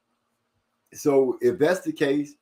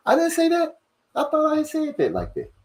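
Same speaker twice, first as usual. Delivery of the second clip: surprised; 14 semitones higher than usual. Real, not acted. Surprised at own misreading.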